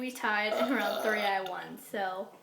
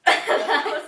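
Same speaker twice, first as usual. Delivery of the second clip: laughing; 5 semitones higher, 14 dB louder than usual. Real, not acted. Laughing after burping round.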